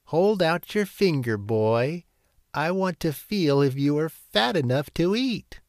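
high, creaky witch demand; a hint of a greedy witch. A narrator's reading. Witch speaks to caged Hansel.

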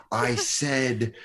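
drawn-out, deliberate repeat; slow, insistent restatement of dirty talk; repeating himself slowly. Acted. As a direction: say it again, very slowly and plainly. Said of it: "Is this the guy repeating it?".

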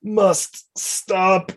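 fighting his own programming, hammed up; robot straining to break free of control; robot struggling. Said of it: "And then Tank's like".

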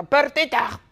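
high exasperated call; high-pitched cry of exasperation; yelled name. Acted. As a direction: shout the name back in protest. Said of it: "le grita a Bertita".

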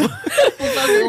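high, slow, laughing please; pleading. Retold or imitated. Sister echoing the plea to dad, high and drawn out. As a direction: beg him, half laughing. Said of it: "Pai, calma".

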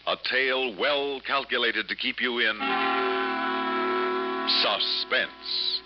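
booming dramatic radio intro; old radio announcer. Acted. Suspense show announcer intro.

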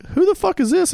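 high-pitched incredulous question, playfully retold; mock disbelief. Friend quoting girls looking at his phone, mock shocked. Retelling two girls seeing the photo.

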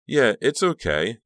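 ordinary delivery

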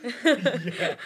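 loud, high laughing yeah. Real, not acted. Laughing at cough joke.

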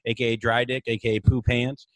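usual speaking voice

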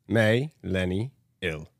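Millennial broken into May, Lenny, ill slowly; halting, chopped pronunciation; split syllables. Shown as a demonstration. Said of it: "Why are you talking like that?".

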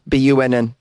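usual speaking voice

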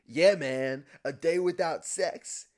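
hammed-up slow guy voice; dumb bro voice. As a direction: lay it on like a clueless bro. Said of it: "he's like".

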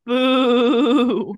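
long, loud jeering boo; playful booing. Pretend. Co-host jeering the sad rose, playful booing. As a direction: boo it like a heckler, drawn out.

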